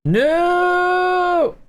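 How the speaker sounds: long, high-pitched held "no"; drawn-out mock refusal. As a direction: wail the refusal high and sustained, playfully.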